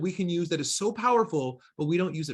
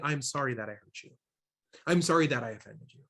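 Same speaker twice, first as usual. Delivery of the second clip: lower, softer, genuine apology; mean it, own the hurt gently; sincere apology; 6 semitones lower, 4 dB quieter than usual. Shown as a demonstration. Trainer modeling a real apology to someone hurt, sincere and soft. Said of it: "That is an expression of empathy".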